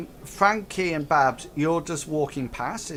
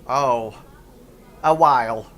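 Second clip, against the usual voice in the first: space goblin; 9 dB louder than usual. Acted. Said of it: "the space goblin".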